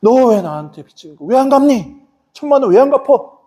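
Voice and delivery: acted anger, pitch climbing sharply; angry creditor. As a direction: demand repayment from the man who owes you. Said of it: "이 사람이 화가 나갖고". (화가 나서 점점 높은 소리로 따지듯)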